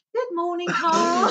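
put-on high English accent greeting; chirpy English morning hello. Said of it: "Do more than just".

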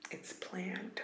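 secretive whisper. Acted. Whispered repeat of the same words.

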